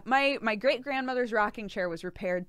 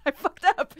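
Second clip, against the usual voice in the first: laughing; 8 semitones higher than usual. Real, not acted. Laughing at own unpreparedness.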